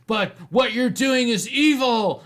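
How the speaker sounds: high, loud, slow scold; prim condemnation. Retold or imitated. Churchwoman scolding the medium, high slow loud prim scold. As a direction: pronounce it like a church lady's verdict.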